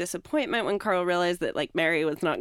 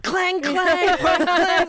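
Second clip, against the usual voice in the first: loud, high mimicked clangs; imitated crashing noise, pitched up; cartoon clanging; 9 semitones higher, 8 dB louder, much slower than usual. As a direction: bang out the clangs like cartoon racket. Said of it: "you know, on cartoons".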